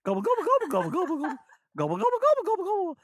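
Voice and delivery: very high, loud turkey gobbles; backwards turkey gobble. Pretend. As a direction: gobble like a turkey played in reverse. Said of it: "sound like I was going backwards".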